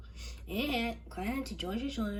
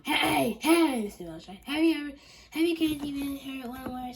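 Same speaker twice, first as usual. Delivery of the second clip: shouting; 7 semitones higher, 10 dB louder than usual. Acted. Kid shouting at teacher in story.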